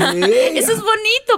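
high, loud laughing delight. Real, not acted. Laughing after moving story.

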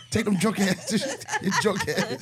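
high teasing between laughs; laughing. Pretend. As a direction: laugh out the insult. Teasing a drunk clubgoer.

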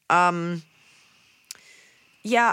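ordinary talk, nothing marked

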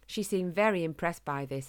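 ordinary delivery, nothing marked

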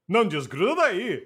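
lightly voiced Portuguese movie dubber; dubbing actor impression. Imitating the Portuguese dub for a friend, dubbed-hero inflection. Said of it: "a pretty good impression of the voice".

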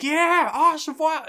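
high, loud excited cheer of a crowd; mock crowd cheer. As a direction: cheer it out like the whole school. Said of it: "everybody's cheering".

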